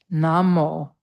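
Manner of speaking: "Namo" with the A of father, NAH-mo; Namo with open A. Shown as a demonstration. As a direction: say it like a teacher modelling. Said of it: "the A that you see in transliterated text is almost always the A in father".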